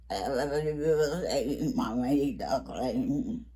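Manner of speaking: a playful muffled underwater voice. Acted. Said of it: "That was my underwater voice".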